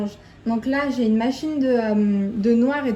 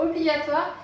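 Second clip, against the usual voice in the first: ordinary talk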